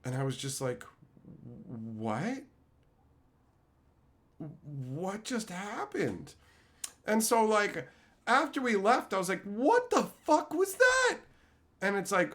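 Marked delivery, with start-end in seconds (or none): 1.6-6.3 soft, slow, bewildered; stunned disbelief. Retold or imitated. Narrator stunned by the crackhead trashing the coffee maker, soft and slow. 9.4-11.2 high, slow disbelieving outburst; mock incredulous. Asking his friend after leaving, high and incredulous.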